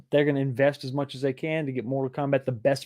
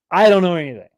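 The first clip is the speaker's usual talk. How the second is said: loud, emphatic protest of innocence; 3 semitones higher, 8 dB louder, faster than usual. Pretend. Host insisting to his viewers that he knows nothing, loud. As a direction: belt it out as a joking protest.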